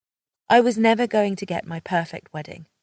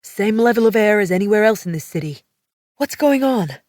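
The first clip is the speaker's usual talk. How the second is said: louder, fast male voice, pushing; angry demand; 3 semitones higher, 7 dB louder than usual. A narrator's reading. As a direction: demand answers. Riggs angry, pointing.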